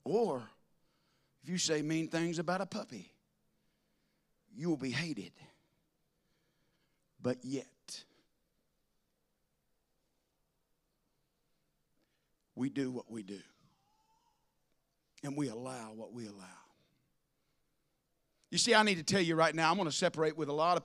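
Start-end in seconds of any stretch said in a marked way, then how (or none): 4.6-16.4 much softer, lower, grave; hushed preaching. Acted. Pastor confides to his church, hushed and grave. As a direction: drop the voice to a solemn hush. Sermon drops to hush.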